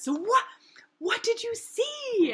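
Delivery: high, loud, drawn-out friend imitation; Lonnie. Friend quizzing her on her return, high mock voice. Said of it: "And she says".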